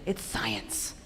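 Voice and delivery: ordinary talk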